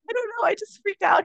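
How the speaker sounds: re-enacted high, tearful voice; squeaky, overwhelmed mock sob; weepy. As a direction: say it as if getting choked up. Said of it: "I got a little weepy".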